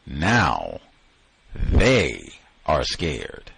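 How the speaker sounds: "they" drawn out slowly with a falling tune; stress on THEY. Shown as a demonstration. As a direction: pause after "Now," then stretch "they". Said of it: "they have they in, uh, italics".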